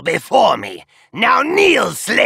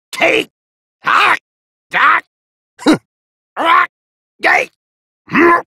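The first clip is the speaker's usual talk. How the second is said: short, forceful high yelps; attack grunts; 11 semitones higher, 4 dB louder than usual. Acted. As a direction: throw each strike with a sharp loud cry.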